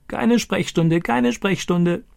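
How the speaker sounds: high, slow squawking parrot voice; crowing parrot. Acted. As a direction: crow it out like a talking parrot. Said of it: "kräht er".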